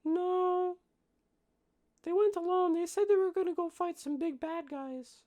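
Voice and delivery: put-on very high little kid voice; small child. Young halfling answering the adults' questions, in a high child voice. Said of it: "this child cannot".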